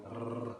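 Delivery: long trilled R; drawn-out tongue-tip trill; rolled R. Shown as a demonstration. Teacher showing the Liverpool R, long rolled trill. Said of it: "a lot of rolling their R's".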